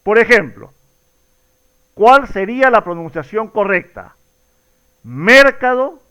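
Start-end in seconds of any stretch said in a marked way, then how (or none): 5.0-6.0 ¿Mercado? with the first syllable MER hit hard, high and loud; stress on MER. Shown as a demonstration. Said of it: "jugar de alguna manera a cambiar la fuerza de voz".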